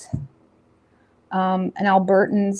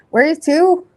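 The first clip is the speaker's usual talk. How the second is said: higher, louder Newfoundland "Where are you to?"; Newfoundland accent; 7 semitones higher, 5 dB louder than usual. Retold or imitated. Mimicking a Newfoundlander for viewers in a louder Newfoundland accent. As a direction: ask it like a Newfoundlander, up and loud. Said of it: "Newfoundland's like".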